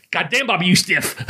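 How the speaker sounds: high, lightly put-on Japanese wrestler's voice; a hint of an accented complaint; mock wrestler griping mid-match; imitating Sasuke. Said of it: "I can do ... this voice".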